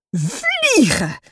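high outcry of revulsion; horrified yell. A narrator's reading. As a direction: cry out at a nasty discovery. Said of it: "gilt ze".

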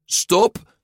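high, loud one-word command; abrupt halt call. A narrator's reading. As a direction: cut him off with a sudden call. Giulia halts Luca abruptly.

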